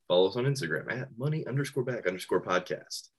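quick, soft disclaimer-style plug; fast promo read. Pretend. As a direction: rattle off the handle like ad fine print. Podcast plug in announcer voice.

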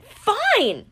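high, sharp shouted "Fine!". A narrator's reading. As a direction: snap it up at the sky in frustration. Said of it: "Chiron shouted".

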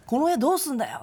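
gruff demanding question. Retold or imitated. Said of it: "めっちゃ怒ってるって思って".

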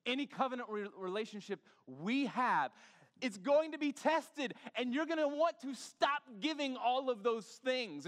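rising to a loud, high preacher's cry; full-throated sermon climax; preaching at full force. Acted. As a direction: drive it home to the congregation. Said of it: "preaching build".

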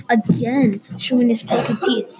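usual speaking voice

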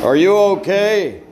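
high, loud check-in call. Acted. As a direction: call out to someone who may be sick. Calls out to sick partygoer.

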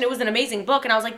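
usual speaking voice

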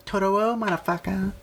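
high mock Chow from Hangover; Chow impression. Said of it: "You know that guy Chow from, uh, Hangover?".

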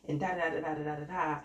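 normal talking voice

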